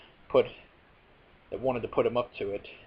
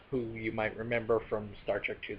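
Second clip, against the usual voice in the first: ordinary delivery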